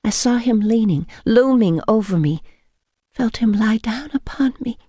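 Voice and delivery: ordinary delivery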